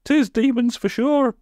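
very high, slow squeal; hammed-up villager in a panic; panicked shriek. A narrator's reading. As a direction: squeal it out, stretching the words. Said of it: "shrieked another".

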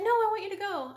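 high, loud retold pleading demand; insistent. Sister pressing her reluctant sibling to go, insistent. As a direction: push back harder, pitch jumping up.

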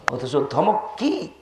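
ordinary delivery

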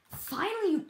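high, loud outburst of "finally"; sudden high yell; exclaiming. Real, not acted. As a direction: shout it out high. Exclaims when the task finally works.

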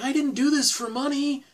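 high-pitched protest; quoting Billy. Retold or imitated. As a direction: lift the voice high for the quote. Acting out Billy's indignant line in the moment.

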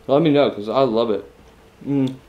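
talking between bites; chewing. Real, not acted. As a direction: mouth partly full. Seen 1: bit food at -2.0; closed, working mouth during words.

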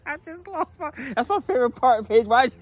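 high, loud mock complaint; playful fake outrage at her; hammed-up grievance; mock mad. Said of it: "I'm so mad".

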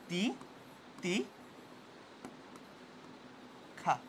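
তি- তি- খা। broken into separate syllables with long pauses. Shown as a demonstration. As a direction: spell out তি, তি, খা slowly for the class. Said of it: "তিতিক্ষা ওয়ার্ডটা দেখতে হচ্ছে এমন". (ধীরে ধীরে আলাদা করে ভেঙে বলা)

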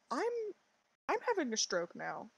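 ordinary delivery

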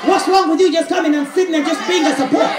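escalating full-force sermon delivery; preaching crescendo. Real, not acted. Preacher urging congregation to support the kingdom, rising to a shout. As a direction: build from firm appeal to high, loud shouting.

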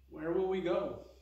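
acted forlorn little question; lost and uncertain. A narrator's reading. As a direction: ask as if suddenly adrift. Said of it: "she looked lost for a moment".

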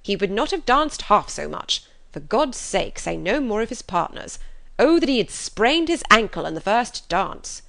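impatient outburst. Acted. Husband cutting off his chattering wife, impatient. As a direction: cut her off in irritation. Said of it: "cried her husband impatiently".

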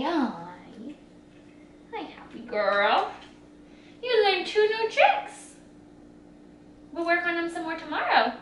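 quiet and slow, gently soothing; soft tender voice. Real, not acted. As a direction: hush down softly for a tired pup. Tired dog soothed.

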